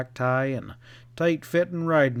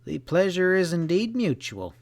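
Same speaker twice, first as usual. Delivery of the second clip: stiffly assured; 6 semitones higher than usual. A narrator's reading. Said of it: "he assured her stiffly".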